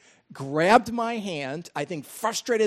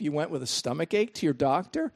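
ordinary talk

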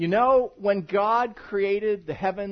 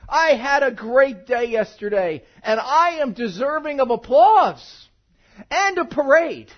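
a louder, puffed-up brag, slowed down; hammed-up self-praise; mock boastful; 6 semitones higher, 11 dB louder than usual. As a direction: show off like you deserve a parade. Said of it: "I come in here this morning and say".

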